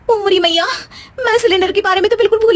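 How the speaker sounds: oh no, I forgot everything; a sudden dismayed cry; panicked cry. Acted. Bahu remembers forgotten cylinder.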